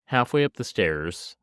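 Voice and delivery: normal talking voice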